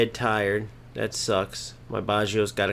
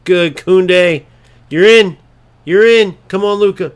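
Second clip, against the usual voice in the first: high, loud eager yell; excited shouting; 7 semitones higher, 12 dB louder than usual. Real, not acted. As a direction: cheer him through on goal. Shouting as chance opens.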